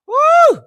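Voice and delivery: high, loud "woo"; loud hyped whoop. Real, not acted. Hyping Cynthia G to her viewers, loud high whoop. Venting, hypes up an ally.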